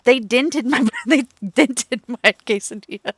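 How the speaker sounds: laughing. Real, not acted. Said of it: "laughing while complaining".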